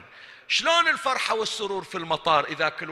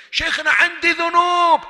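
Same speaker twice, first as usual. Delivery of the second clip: loud pleading objection; 14 semitones higher, 12 dB louder than usual. Acted. مستمع يعترض على الشيخ.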